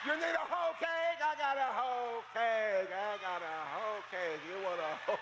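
slow, sly mock offer; playful innuendo; teasing. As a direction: tease them with a drawn-out pun. Teasing hoe cake offer.

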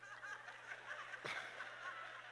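soft wheezing giggle; faint laughing tail; quiet breathy laugh. Real, not acted. Still laughing at hoe cake.

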